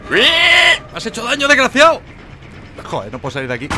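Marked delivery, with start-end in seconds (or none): 0.1-2.0 high, loud cry of protest; playful indignant shout; mock outraged yell. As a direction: yell at the one who hurt you. Yells at player who hurt him.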